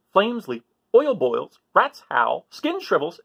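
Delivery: plain reading voice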